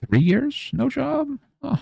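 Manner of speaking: skeptical voice squeaking way up on three years; incredulous interviewer, pitch shooting high; mock hiring manager. Job interviewer sneering at a jobless applicant, high incredulous voice. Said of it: "they very well might look at me and be like".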